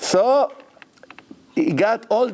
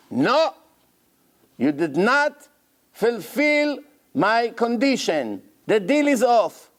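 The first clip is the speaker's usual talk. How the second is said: 1 stubborn seller; 6 semitones higher, slower than usual. Retold or imitated. Seller refusing brokers.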